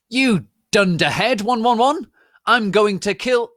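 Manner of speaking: high loud furious shout. A narrator's reading. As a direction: scream it like a hammy villain. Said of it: "shooted Snape".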